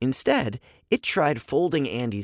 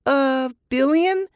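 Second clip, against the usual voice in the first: unsure guess; 4 dB louder, slower than usual. A narrator's reading. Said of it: "Eva tried a high number".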